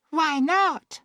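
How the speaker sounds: put-on very high girlish query; girl's voice. A narrator's reading. Emily to boatman, high girlish questioning.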